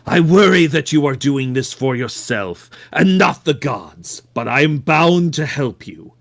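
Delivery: measured, solemn cult devotee voice; calm solemn devotee. Acted. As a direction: speak like a serene zealot. Player voices Witness character.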